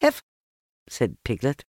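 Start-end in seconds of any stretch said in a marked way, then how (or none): 0.0-0.2 one cut-off, breathless syllable; out of breath. A narrator's reading. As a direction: barely get the word out, winded. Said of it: "breathing so hard that he could hardly speak".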